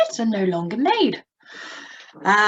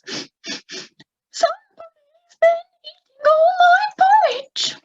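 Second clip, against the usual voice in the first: much higher, louder, tearful wobble; trembling Baby Bear; 6 dB louder than usual. Shown as a demonstration. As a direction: quiver like a sad little bear. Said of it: "a bit of a tremble in your voice".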